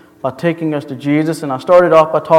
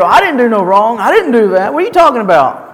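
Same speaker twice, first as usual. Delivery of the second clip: louder, higher, hasty protest of innocence; defensive and angry; 5 semitones higher, 4 dB louder than usual. Shown as a demonstration. Pastor acting the offender who denies the wrong. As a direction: get hasty and defensive, brushing it off. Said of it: "excited and angry and frustrated".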